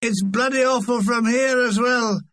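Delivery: put-on high-pitched drunken heckle; shouted heckle. Drunk heckler back through the exit, shouting. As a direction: yell it in from the fire exit. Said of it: "he shouted".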